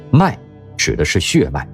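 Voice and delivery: usual speaking voice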